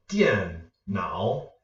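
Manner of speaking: "Dian" falling, "nao" dipping; fourth plus third tone. Shown as a demonstration. Teacher giving viewers an example word, falling then dipping. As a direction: drop "Dian" sharply, then dip and lift "nao". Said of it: "a fourth tone with a third tone".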